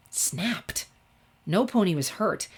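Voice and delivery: ordinary delivery, nothing marked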